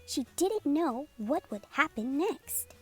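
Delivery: plain reading voice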